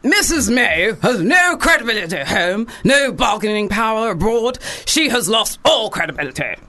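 put-on accent, punchy oratorical swings; Paddy Ashdown accent. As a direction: imitate a politician's accent with swooping, emphatic delivery. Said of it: "you gotta do it in his accent".

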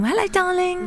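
high, soft sing-out hello; darling greeting. Shown as a demonstration. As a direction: greet like an old pal whose name escapes you. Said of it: "I always call them darling".

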